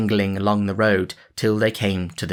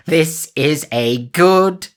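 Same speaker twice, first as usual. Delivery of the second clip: very slow, higher, louder rejoicing; joyful cry; 8 semitones higher, 6 dB louder, much slower than usual. A narrator's reading. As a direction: savour the triumph. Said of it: "cried he".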